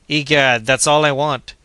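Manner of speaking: defiant exclamation; stubborn outburst. A narrator's reading. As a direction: dig in his heels out loud.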